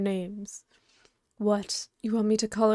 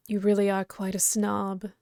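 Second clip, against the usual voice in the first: ordinary delivery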